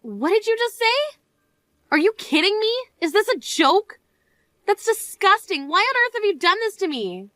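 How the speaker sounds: can't believe what she just heard; shocked disbelief. Acted. Bride finds dress ruined.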